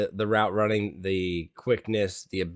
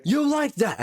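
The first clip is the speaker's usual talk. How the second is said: high-pitched, emphatic catchphrase impression; Kirk Cousins. Podcast co-host redoing a quarterback's catchphrase on request, emphatic impression. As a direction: punch out YOU much higher, with real emphasis. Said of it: "little more emphasis".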